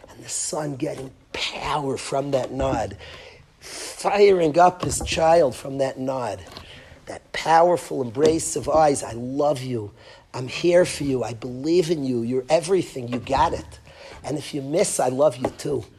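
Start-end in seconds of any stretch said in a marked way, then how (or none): 0.1-3.0 hushed whisper. Acted. Painting the father's nod for parents, whispered. Intimate picture of father and son. 9.0-15.8 soft, low, loving father's voice; tender father. Acted. Father locking eyes with his son, tender and soft.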